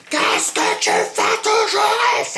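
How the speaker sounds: very high, slow drawn-out scream; hysterical shout. Retold or imitated. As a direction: scream it slowly like a madwoman. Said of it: "j'étais dans un vrai personnage".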